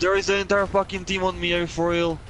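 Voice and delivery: shouting orders over battle. Real, not acted. Swarmed by enemy team.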